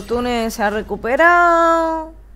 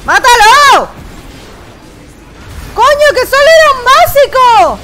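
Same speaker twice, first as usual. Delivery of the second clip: loud high furious yell; furious shout; 14 semitones higher, 12 dB louder than usual. Real, not acted. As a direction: scream it in gamer rage. Furious at teammate's play.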